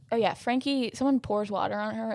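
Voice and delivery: ordinary talk, nothing marked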